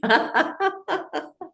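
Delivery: high bubbling laugh; high, tumbling laughter; demonstrated laughter. Shown as a demonstration. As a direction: laugh like water starting to bubble. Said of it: "I start laughing".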